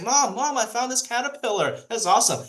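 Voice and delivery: put-on high, eager little boy voice; excited kid. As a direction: squeal it out like a thrilled child. Excited kid calls mom.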